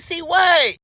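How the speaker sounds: high, puzzled demand; confused. Retold or imitated. Woman puzzled by fiance's question, high and confused. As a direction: toss it back baffled.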